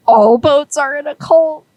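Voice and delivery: a baffled newcomer gasping at the ritual; alarmed question. Retold or imitated. Zero alarmed at christening.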